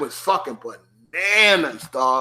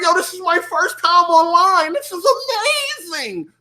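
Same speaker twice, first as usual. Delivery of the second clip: higher, excited first-timer; giddy newbie; 10 semitones higher than usual. Retold or imitated. Answering the other players, still thrilled. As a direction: gush about being online at last. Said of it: "I'm like".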